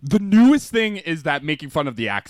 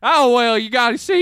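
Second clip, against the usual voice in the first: put-on Alabama Southern accent; 6 semitones higher, 4 dB louder than usual. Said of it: "like, Southern, like, Alabama".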